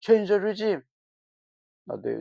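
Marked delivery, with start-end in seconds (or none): 0.0-0.8 high, mocking voice of a fretful dictator. Mocking how a dictator would supposedly fret about his people. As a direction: ham up a worried tyrant to mock the idea. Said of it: "do you think he's like".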